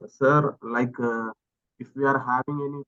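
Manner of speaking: ordinary talk, nothing marked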